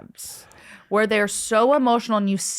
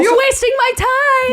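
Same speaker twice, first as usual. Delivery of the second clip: much higher and louder, squealed impatience; exasperated mocking quote; 7 dB louder than usual. Imitating herself scolding shy British men, squealing impatiently. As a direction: snap back as if totally fed up. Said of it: "And you're like".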